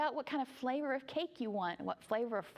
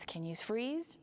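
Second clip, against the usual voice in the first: plain reading voice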